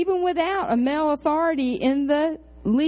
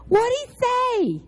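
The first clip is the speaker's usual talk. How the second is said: nosy eager ask; 12 semitones higher, slower than usual. Retold or imitated. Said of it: "I'm nosy".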